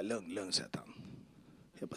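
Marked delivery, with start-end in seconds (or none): 0.0-0.5 calm hush. Retold or imitated. Calming the lawyer, retold.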